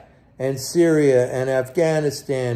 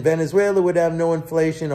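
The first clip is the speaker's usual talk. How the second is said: ordinary talk, nothing marked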